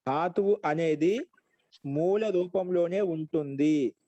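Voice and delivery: slow phrases with drawn-out endings; slow dictation. Shown as a demonstration. Slow dictation for note-taking. (నెమ్మదిగా రాయించే స్వరం)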